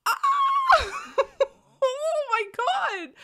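high squealing laugh, overwhelmed; excited squeal. Real, not acted. Fan squealing to viewers about episode seven, overwhelmed.

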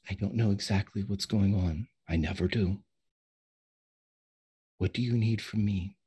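low, quiet, lightly acted character voice; understated, hushed in-character delivery; softly voiced Maggie. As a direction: speak gently and low as a calm helper. Game master voices Miss Maggie.

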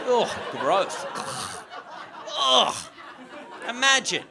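loud, high groan of revulsion; hammed-up revulsion for the crowd; mock disgust. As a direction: recoil loudly in mock horror. Acted disgust at laundromat people.

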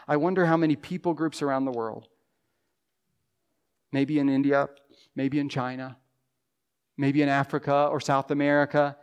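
ordinary delivery

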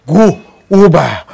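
normal talking voice

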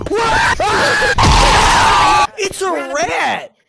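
loud shrieks of disgust; startled scream. Real, not acted. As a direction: shriek in surprise. Startled by rat.

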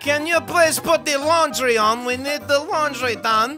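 comic Kazakh character voice; Borat. Acted.

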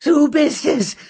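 high, loud bellow; raging accusation; furious roar. A narrator's reading. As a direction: loom over her and yell it. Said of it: "brüllte Lanky".

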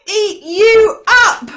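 loud, high, drawn-out threat; menacing troll. Acted. Troll to middle billy goat, loud slow threat. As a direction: punch each word out slowly.

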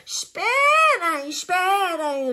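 high, slow whinnying plea; horse-like neigh on the words; neighing zebra. A narrator's reading. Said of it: "relincha a zebra".

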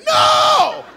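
high, full-volume horrified yell; panicked scream. Retold or imitated. Brother waking in the car in costume, screaming in panic.

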